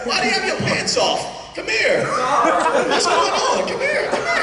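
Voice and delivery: high-pitched incredulous questioning; comic alarm, pitch climbing high; startled disbelief. Acted. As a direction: call them over in high-pitched astonishment. Acted shock at pantsless volunteer.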